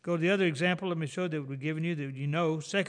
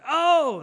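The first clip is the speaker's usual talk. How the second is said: loud, high startled yelp; hammed-up pained yelp; mock recorded yelp; 11 semitones higher, 12 dB louder than usual. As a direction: imitate the recorded voice crying out. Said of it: "You hear this".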